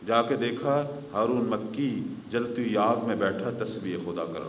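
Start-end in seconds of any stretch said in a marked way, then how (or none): none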